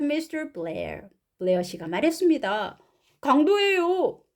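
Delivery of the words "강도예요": high, slow exclamation; raised storybook voice; alarmed story father. Acted. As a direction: cry out as startled dad. Mr. Blair's alarm in translation.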